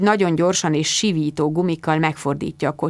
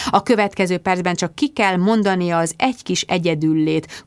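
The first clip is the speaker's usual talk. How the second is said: ordinary delivery, nothing marked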